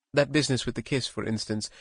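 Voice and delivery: ordinary delivery, nothing marked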